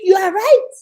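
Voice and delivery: ordinary delivery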